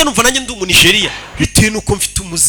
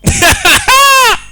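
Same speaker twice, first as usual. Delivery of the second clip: real, loud, high-pitched laughter; 5 dB louder than usual. Real, not acted. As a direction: burst out in a high cackle. Narrator laughs at Rocky remark.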